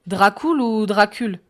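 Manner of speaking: "Dracul ou Dracul?" slow and loud, comparing two vowels; questioned pronunciation. A narrator's reading. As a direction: say "Dracul ou Dracul?" slowly, contrasting oo with French u. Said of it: "Répète-moi ça".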